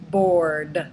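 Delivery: "bored" with a firmly voiced final d; strong voiced ending. Shown as a demonstration. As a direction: land hard on the voiced d closing "bored". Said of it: "make sure they're very strong endings".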